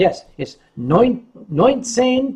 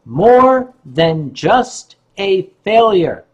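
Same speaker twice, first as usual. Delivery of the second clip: very slow, measured title; slow dictation; 3 dB louder, slower than usual. Shown as a demonstration. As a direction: spell out the title word by word for note-takers. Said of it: "Write that down".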